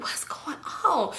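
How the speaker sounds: slow, hushed whisper; puzzled whisper. Retold or imitated. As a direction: whisper it like a baffled eavesdropper. Secretly wondering while eavesdropping.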